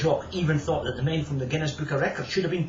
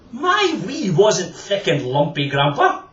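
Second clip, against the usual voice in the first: indignant protest; 5 semitones higher, 7 dB louder, slower than usual. Acted. George protesting to Grandpa.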